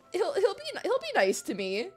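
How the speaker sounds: ordinary talk, nothing marked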